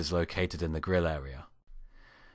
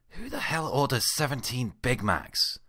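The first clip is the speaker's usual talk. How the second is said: grumbled; 7 semitones higher than usual. A narrator's reading. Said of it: "I grumbled".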